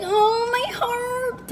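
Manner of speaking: high, slow, hammed-up weepy wail; mock crying. Mocking the ex who wants his family back, slow high weepy wail. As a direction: mimic a man sobbing over his lost love. Mocking the ex's tearful remorse.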